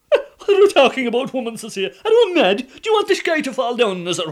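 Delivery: high, loud laughing disbelief; scoffing at a mad notion; husband hooting at his wife's scheme; incredulous scoff. Acted. Husband laughs at pope idea.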